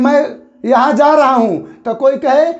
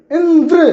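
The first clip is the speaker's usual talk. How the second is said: Indra higher, with a forceful final द्र; stress on द्र; 4 semitones higher than usual. Shown as a demonstration. Teacher repeating for pupils to practise, stress on द्र in Indra. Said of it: "ये ज़्यादा बल लग रहा है".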